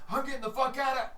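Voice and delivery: high, panicked flee cry; a thug bolting for the door, yelling as he runs. Acted. High, panicked thug running for the exit. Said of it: "and he's like".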